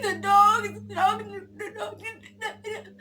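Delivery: put-on high, breathless child repeating the dog; tearful child. Little girl telling her dad, high upset stammer. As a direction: stammer it out like a scared toddler.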